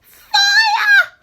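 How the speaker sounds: high, loud shriek of fire; shrieking alarm; yelled. A narrator's reading. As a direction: yell it to the rafters. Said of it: "yelled the old woman".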